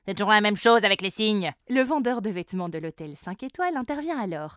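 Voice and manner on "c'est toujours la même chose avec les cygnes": loud grumbling duck; grumpy duck. Acted.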